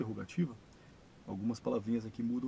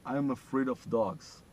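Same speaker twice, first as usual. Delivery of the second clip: slow, careful, louder English model line; slow model sentence; 5 dB louder, much slower than usual. Shown as a demonstration. Teacher modelling English for students, slow and clear. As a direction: read it out slowly for the class to repeat. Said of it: "Esse é o significado da frase".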